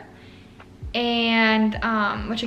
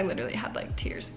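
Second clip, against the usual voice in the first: whisper; 10 semitones lower, 8 dB quieter than usual. Real, not acted. Recalling crying.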